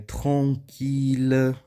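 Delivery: "tranquila" said slowly as French "tranquille", L pronounced; French exception, L sounded. Shown as a demonstration. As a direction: draw out "tranquila" in French, keeping the final L. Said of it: "E aí temos exceções".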